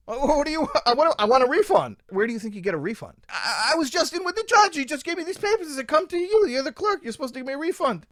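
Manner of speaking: high, loud, panicky protest; frantic pleading. Retold or imitated. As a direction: beg the clerk, voice climbing high. Pleading with the clerk.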